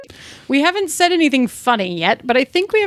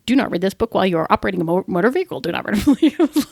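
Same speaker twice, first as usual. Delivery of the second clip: rattled off low and very fast; fast disclaimer; 6 semitones lower, faster than usual. Shown as a demonstration. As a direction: read it like fine print in an ad. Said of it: "low text spoken extremely fast".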